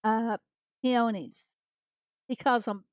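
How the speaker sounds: usual speaking voice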